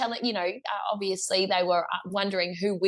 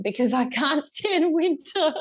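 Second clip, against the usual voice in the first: louder, higher, breaking into a laugh; 7 semitones higher, 5 dB louder than usual. Real, not acted. Guest admitting she avoids winter overseas, laughing. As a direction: laugh through the admission.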